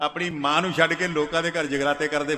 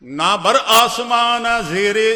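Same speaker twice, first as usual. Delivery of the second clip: higher, louder and slow, proclaimed to the congregation; declaiming; 5 semitones higher, 7 dB louder, slower than usual. Acted. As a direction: ring out the line from the pulpit. Preacher declaiming the verse. (ਉੱਚੀ ਆਵਾਜ਼ 'ਚ ਐਲਾਨ ਵਾਂਗ)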